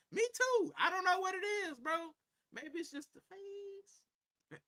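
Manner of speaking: high, playful squeak of a voice; boasting to chat about his face in a high joking voice; mock falsetto boast. Joking that women find him irresistible.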